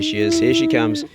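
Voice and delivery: telling the dog Poe is coming, in a squeaky high voice; squeaky dog talk. Real, not acted. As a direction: sing it up high to excite the dog.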